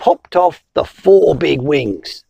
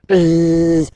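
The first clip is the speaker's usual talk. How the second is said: buzzing; 2 semitones lower than usual. Acted. Storyteller buzzing like a bee. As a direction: buzz like a bee.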